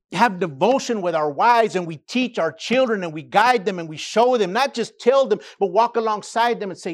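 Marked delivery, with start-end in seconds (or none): none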